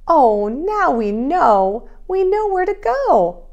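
slow, loud, sing-song delight; joyful realisation. A narrator's reading. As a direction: savour the discovery, rising high. Bees' joyful discovery.

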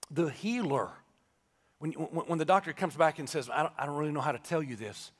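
low, soft preacher's comfort; solemn, reassuring preaching. Real, not acted. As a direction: bring it down and reassure them gently.

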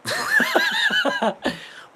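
very high, loud laughter; laughing. Real, not acted. Amused by a kind of insult, laughing. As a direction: crack up helplessly.

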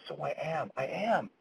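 soft, tearful answer; a quiet tearful yes; tearful whisper. Retold or imitated. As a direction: barely hold back tears. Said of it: "And Cordelia says".

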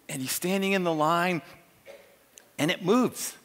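normal talking voice

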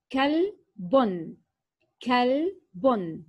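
كلب drawn out slowly, its first sound a crisp k from the back of the mouth; slow kaf demo. Shown as a demonstration. Teacher to children, slowly sounding out كلب with a clear k. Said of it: "حرف الكاف".

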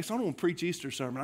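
ordinary talk, nothing marked

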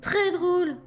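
high, frightened retort; fearful, mildly cross woman. A narrator's reading. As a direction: snap back in a high, scared voice. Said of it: "d'un ton plus apeuré que furieux".

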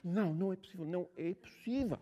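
amazed disbelief. Retold or imitated. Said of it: "Ela dizia isso e ela começava a chorar".

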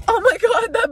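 sudden high loud oh my God; startled. Real, not acted. Vlogger blinded by the sun, startled high cry. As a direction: jolt as the light hits.

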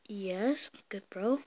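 hushed, sheepish whisper. Acted. A kid answering his mom's question, whispered. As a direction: mumble it soft and unvoiced.

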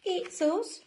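very high storybook call; penguin siblings calling. Acted. Brothers and sisters at Dudley's door, high call. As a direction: pipe up at the door.

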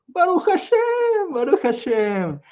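high, slow, exultant blessing; joyful praise. A narrator's reading. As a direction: sing out thanks to God. Simha praises God joyfully.

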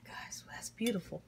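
soft, hushed whisper; quiet aside whispered to viewers. Real, not acted. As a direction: whisper it under your breath. Whispering over food.

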